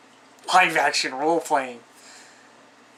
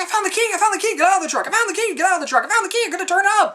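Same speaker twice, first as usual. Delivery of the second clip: much higher, giddy mock voice, rushing faster at the end; excited dimwit; 4 dB louder, much faster than usual. Guy in the truck ignoring the warnings, giddy high mock voice. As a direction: play him as a clueless, thrilled guy who found the key. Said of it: "He's like".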